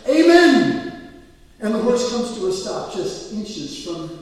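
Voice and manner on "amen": high, loud, drawn-out Amen; desperate yell. Retold or imitated. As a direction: yell it to stop the horse at the last second. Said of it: "The last minute he yells".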